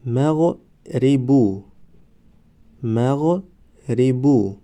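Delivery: مغربو stretched very slowly, syllable by syllable; drawn-out demo. Shown as a demonstration. Teacher modelling the word for students, very slow. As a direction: draw out مغربو slowly for the class. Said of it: "ما باید همان حرف را فقط آوازش را تلفظ کنیم".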